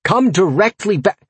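high, exasperated yell; yelled order, cut off. A narrator's reading. Master yelling at the circling imp, exasperated. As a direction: pitch it up, break off mid-word. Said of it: "I yelled".

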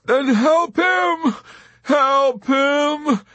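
high, loud, anguished wail; weeping plea. A narrator's reading. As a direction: wail it, begging for help. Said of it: "Dobbs wept".

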